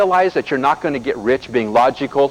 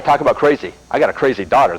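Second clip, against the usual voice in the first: normal talking voice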